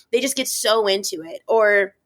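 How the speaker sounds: ordinary talk, nothing marked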